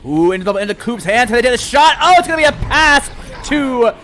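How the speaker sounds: rising excited goal call; excited play-by-play. Real, not acted. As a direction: build the hype on the score.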